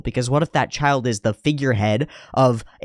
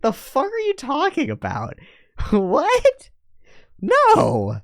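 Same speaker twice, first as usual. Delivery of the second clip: much higher mock girl voice, disgusted; incredulous girl; slower than usual. Princess rejecting Cliff's proposal, high mock girl voice. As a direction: squeak out the rejection in disbelief. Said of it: "She's like".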